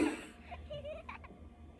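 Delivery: squeaky high giggle. Real, not acted. Laughing at her lost attitude, high giggle. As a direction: let a high giggle slip.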